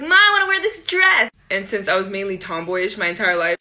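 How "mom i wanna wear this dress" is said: put-on high, loud eager child asking mom; excited little kid. Kid asking mom for the dress, high and eager. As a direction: beg mom like a thrilled nine-year-old. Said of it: "I was probably like".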